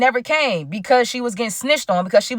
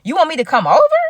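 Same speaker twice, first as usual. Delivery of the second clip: higher, incredulous rising question; surprised question; 5 semitones higher, 4 dB louder than usual. Retold or imitated. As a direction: act out delighted disbelief at an invitation. Astonished at the invitation.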